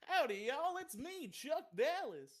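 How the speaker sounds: overdone Southern cowboy drawl, pitched high; hammed-up Southern accent. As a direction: play a cowboy comic swaggering in with a big Southern greeting. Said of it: "Putting a little too much twang on there".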